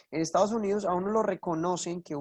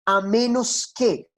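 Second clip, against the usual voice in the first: usual speaking voice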